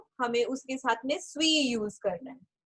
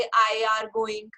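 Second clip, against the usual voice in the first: 1 wrong example, slow; 3 semitones lower, much slower than usual. Shown as a demonstration. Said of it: "ऐसे wrong हो जाता है ना".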